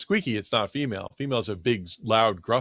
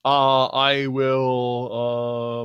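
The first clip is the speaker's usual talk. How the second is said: ordinary talk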